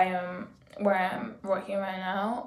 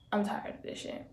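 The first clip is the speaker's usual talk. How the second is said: whispered, weary venting; fed-up whisper; 10 dB quieter than usual. Real, not acted. Telling the universe she is fed up, whispering. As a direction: breathe it out, done with it all.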